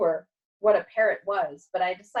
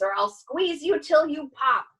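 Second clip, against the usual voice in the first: higher-pitched, emphatic threat; threatening; 7 semitones higher, 4 dB louder than usual. A narrator's reading. Dog with paws round the cat's throat, threatening. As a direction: threaten a friend, hammed up. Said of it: "threatening voice".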